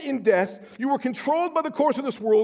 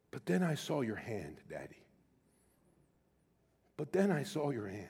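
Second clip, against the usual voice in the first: put-on hushed, much softer small-boy voice; little son's voice; 9 semitones lower, 12 dB quieter than usual. Little son telling daddy he saw his hand, hushed and soft. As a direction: gently voice the small boy's trust. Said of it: "He goes".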